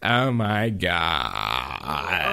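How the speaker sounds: low, very slow creaky drawl; hammed-up fry, GOD dragged out into a croak; exaggerated vocal fry. Shown as a demonstration. Mocking streamers' talk to co-host, exaggerated vocal fry. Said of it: "when you talk like this".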